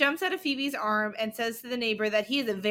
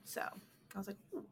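whisper; 3 semitones lower, 20 dB quieter than usual. Real, not acted. Whispered aside.

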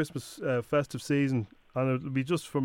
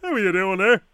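acting as Leo; 3 semitones higher, 5 dB louder than usual. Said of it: "I'm gonna pretend I'm Leo".

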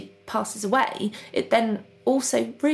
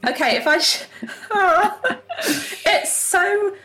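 embarrassed laughing; 9 semitones higher, 4 dB louder, slower than usual. Real, not acted. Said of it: "cracked and crappy".

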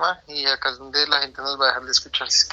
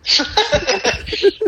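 laugh. Real, not acted. Laughs at own plea.

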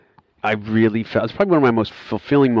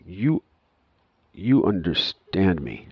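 slow imitated taxi driver; much slower than usual. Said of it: "He said".

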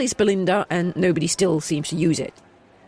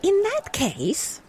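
much higher, slow character voice; youngest elder, proposing; 13 semitones higher, much slower than usual. Acted. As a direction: propose it in a high, measured voice.

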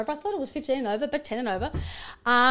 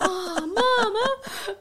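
put-on embarrassed kids' groan; 9 semitones higher, 5 dB louder, much slower than usual. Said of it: "my kids are like".

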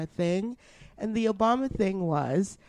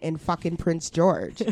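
usual speaking voice

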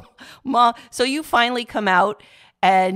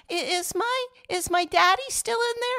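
put-on high small child asking for daddy; high, timid child asking after her dad; little girl voice; 13 semitones higher than usual. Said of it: "And I'd say".

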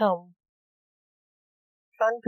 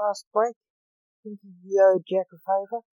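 ordinary delivery

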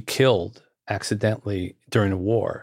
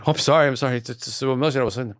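higher, mock-tearful apology; 4 semitones higher, faster than usual. Woman at the book meeting apologizing, imitated tearful voice. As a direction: imitate someone apologizing through tears. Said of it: "a woman in the room burst into tears".

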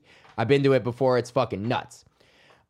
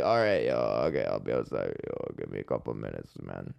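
much lower and softer, groggy from sleep; just-woken-up voice; 8 dB quieter than usual. Retold or imitated. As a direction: mumble it like you just rolled out of bed. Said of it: "Like that voice when you're, like, just waking up".

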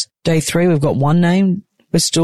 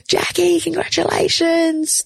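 high, slow, drawn-out cheery call; sing-song congratulations; 13 semitones higher, slower than usual. Retold or imitated. Ladies from the clinic greet new mum through curtains, high sing-song call. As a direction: call it sweetly through the curtain, stretching each word.

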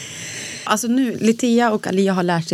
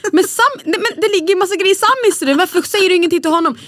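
put-on much higher, complaining child; whiny daughter protest; 9 semitones higher, 3 dB louder than usual. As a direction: protest that it isn't fair. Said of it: "Man bara".

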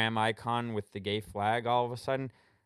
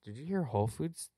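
usual speaking voice

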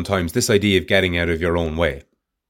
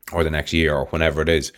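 ordinary delivery

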